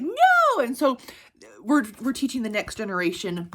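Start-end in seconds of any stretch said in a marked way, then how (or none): 0.0-0.6 high, loud mock protest; playful no. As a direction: shout a teasing no. Answering nephew's pickle question.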